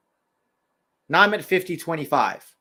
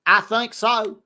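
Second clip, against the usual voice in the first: Texas accent; 6 semitones higher, 4 dB louder, slower than usual. Shown as a demonstration. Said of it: "Can you do a Texas Western accent?".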